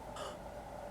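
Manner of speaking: breathless startled gasp; sharp gasp. Acted. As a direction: gasp at the sight. Sees graveyard.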